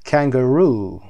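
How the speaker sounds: Kangaroo with a loud, stressed ROO; last-syllable stress. Shown as a demonstration. Teacher showing learners English word stress, strong ROO in Kangaroo. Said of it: "قسمت آخر".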